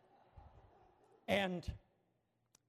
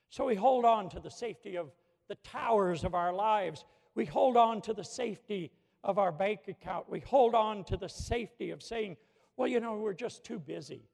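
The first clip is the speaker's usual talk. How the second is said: louder, rhythmic pulpit build; full-force preaching; 7 dB louder than usual. Acted. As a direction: drive each "we hold on" home to the congregation.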